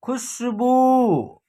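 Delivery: खुशबू slow, short खु then a long held बू; drawn-out ending. Shown as a demonstration. Teacher showing खुशबू's pronunciation, final ऊ pulled long. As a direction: stretch the बू of खुशबू right out. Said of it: "तो इसका उच्चारण होगा".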